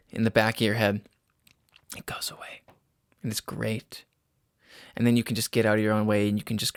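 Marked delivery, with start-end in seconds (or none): 2.0-4.0 soft breathy whisper. Real, not acted. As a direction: drop to a hushed whisper. Dreamy aside about relief.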